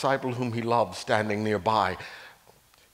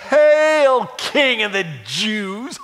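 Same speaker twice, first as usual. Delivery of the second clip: loud, high, slow sneering hail; mocking hail; 11 semitones higher, 10 dB louder, much slower than usual. Soldiers jeering at Jesus in the purple robe, loud slow mockery.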